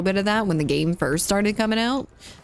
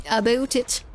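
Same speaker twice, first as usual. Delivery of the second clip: higher mock-Canadian "aboot it"; playful Canadian imitation; Canadian accent; 4 semitones higher than usual. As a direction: pitch it up and stretch a Canadian "oo". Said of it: "sounds like a Canadian saying it".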